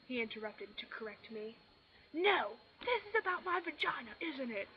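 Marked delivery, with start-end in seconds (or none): 2.1-4.7 high, loud furious shout. A narrator's reading. Bella shouting at Edward, a high loud furious shout. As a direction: hurl it at him, furious. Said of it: "I shouted, furious".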